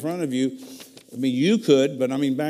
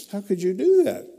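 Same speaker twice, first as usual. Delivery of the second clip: mildly mimicked astonishment, higher pitch; amazed question; 8 semitones higher, 4 dB louder than usual. Wife to husband who quoted scriptures, amazed. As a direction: lift it high in retold amazement.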